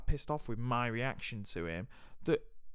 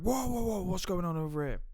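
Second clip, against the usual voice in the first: deputy head teacher; 8 semitones higher than usual. Retold or imitated. Deputy head striding over to the scuffle, high alarmed teacher voice. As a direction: play the teacher swooping in, pitched way up. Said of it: "came over and went".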